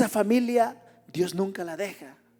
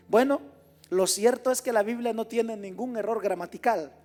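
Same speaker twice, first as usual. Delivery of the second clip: ordinary talk, nothing marked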